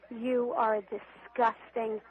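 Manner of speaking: ordinary talk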